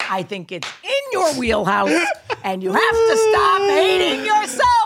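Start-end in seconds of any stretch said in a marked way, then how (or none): 2.4-4.9 high, loud mock scolding; emphatic scolding. Real, not acted. Host urging friend to like himself, emphatic scolding. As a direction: belt out a pep talk, nearly shrieking.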